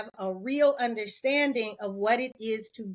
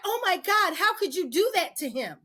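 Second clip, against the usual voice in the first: mock outraged teen; 7 semitones higher than usual. Teen daughter scolding her mother, higher and shocked.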